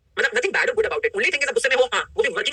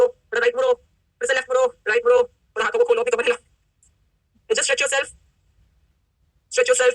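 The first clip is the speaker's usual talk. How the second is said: stretch break coaching; 3 semitones higher, much slower than usual. Acted. Coach getting the seated audience to stand and stretch, upbeat. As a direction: coax the room into moving.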